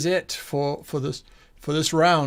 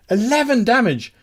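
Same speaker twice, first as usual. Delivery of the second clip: higher, punchier exclamation; excited announcement; 7 semitones higher, 3 dB louder than usual. Real, not acted. Game master hailing the player's big damage, excited. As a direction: announce the number with relish.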